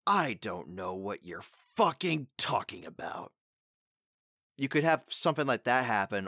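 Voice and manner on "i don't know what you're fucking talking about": slow, weighted "I don't know what you're fucking talking about" with stress on I; slower acted line. Shown as a demonstration. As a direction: lean into it like a show character. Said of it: "the way they say it in the show could be like".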